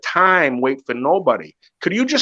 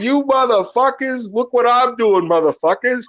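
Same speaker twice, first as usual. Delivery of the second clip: imitating Hagler; 9 semitones higher than usual. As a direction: ham up a boxer calling people out, pitched high. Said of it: "he would be fucking saying".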